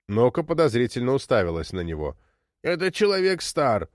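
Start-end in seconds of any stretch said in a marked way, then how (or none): 2.6-3.9 high, insistent contradiction; objecting. A narrator's reading. Old woman disputing who Thomas is, objecting. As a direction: object firmly, pitch climbing. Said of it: "возразила она".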